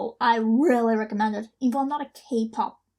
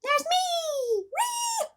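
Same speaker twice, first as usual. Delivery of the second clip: high, shrill-free squeal of delight; thrilled fan shriek; excited squeal; much slower than usual. Real, not acted. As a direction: squeal at seeing yourself. Spotting own name in credits.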